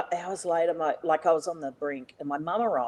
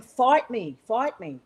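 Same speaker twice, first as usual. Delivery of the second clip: raised-pitch retold goading; insistent challenge, first one louder; acted-out challenge; 5 semitones higher, 3 dB louder, slower than usual. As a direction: re-enact egging someone on to fight. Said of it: "you know, like this".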